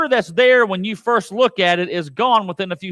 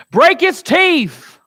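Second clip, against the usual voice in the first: much higher, loud, slow; shouted plea; 8 semitones higher, 6 dB louder, much slower than usual. Acted. Preacher as David crying to God, loud. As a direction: cry it out to heaven.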